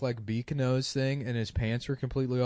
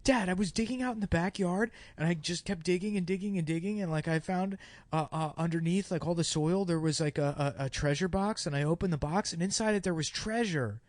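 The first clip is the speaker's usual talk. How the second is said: higher-pitched, lightly acted excited kid; 4 semitones higher, 5 dB quieter than usual. Kid telling dad about backyard treasure, excited. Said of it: "like, excitedly saying".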